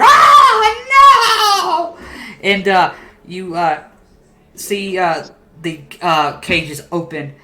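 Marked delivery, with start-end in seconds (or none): 0.0-2.0 long, very high wailing scream; puppet's scream. Acted. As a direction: shriek it out as a puppet falling into a cauldron. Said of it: "You can hear".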